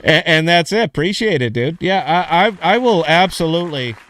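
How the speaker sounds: loud, high, buzzing thanks; real excited gushing. Real, not acted. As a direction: gush it out, pumped. Hyped reply to praise.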